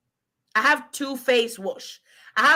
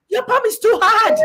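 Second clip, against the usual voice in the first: laughing shout; 8 dB louder than usual. Retold or imitated. Retold complaint at the man.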